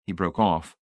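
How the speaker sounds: ordinary delivery, nothing marked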